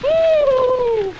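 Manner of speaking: high loud whoop; excited whoop. Acted. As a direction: let out a gleeful whoop. Excited whoop after joke.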